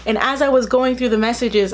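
ordinary talk

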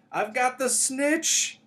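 high, drawn-out yell of triumph; triumphant shout. A narrator's reading. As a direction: shout it out high, loud and long. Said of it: "he shouted".

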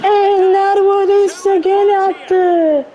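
loud, stretched goal shout; excited goal call. Real, not acted. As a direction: roar the goal call out slowly. Goal call in own commentary.

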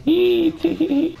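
very high mock giggle; squeaky giggle.

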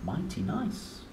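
ordinary delivery, nothing marked

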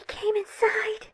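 softly crying in terror; whimpering whisper. Acted. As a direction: choke it out in a sob. Intruder enters hiding place.

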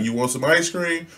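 soft coaxing offer; softly coaxing a crying child. Retold or imitated. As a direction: lure him with ice cream. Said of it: "So I'm like".

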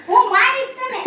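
high-pitched warning; scolding threat. Real, not acted. Family member threatens a smack, scolding. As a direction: raise the pitch in a quick telling-off.

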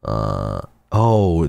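ordinary talk

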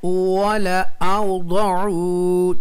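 वला औदउ very slow, final oo held; recitation demo. Shown as a demonstration. Teacher shows students the reading, slow chanted वला औदउ. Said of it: "ज़ैद अलिफ को नहीं पढ़ेंगे".

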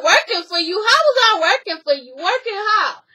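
high, loud and offended; mock indignant comeback. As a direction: throw the question back at her. Acted quarrel, indignant reply.

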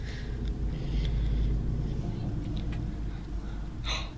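long soft breathy gasp of shock. Real, not acted. Girl sees her school ID on screen, shocked gasp. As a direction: draw breath in sheer alarm. App shows her initials.

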